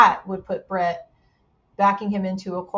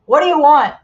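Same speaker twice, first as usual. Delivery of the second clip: gruff shopkeeper; 5 semitones higher, 10 dB louder than usual. Retold or imitated. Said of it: "he just goes".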